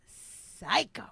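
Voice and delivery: hushed whisper. Real, not acted. Telling friends how he snapped, in a slow whisper. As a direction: drop to a whisper for the dark turn. Dramatic turn in abuse story.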